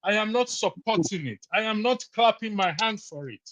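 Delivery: usual speaking voice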